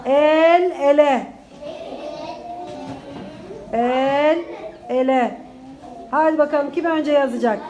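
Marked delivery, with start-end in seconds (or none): 0.0-5.4 slow, syllable by syllable dictation; dictating. Shown as a demonstration. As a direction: spell it out slowly for the class. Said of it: "Yeni sözcüğümüzü söylüyorum". (yavaş yavaş dikte ederek)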